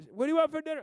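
put-on high, loud child's question; little daughter. Daughter asks again.

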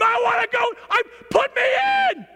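high, insistent shouting over a refusal; eager yelling. Acted. Player arguing with coach who said not yet, high insistent yelling. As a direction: holler desperately to be sent in. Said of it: "We all need to be yelling at him".

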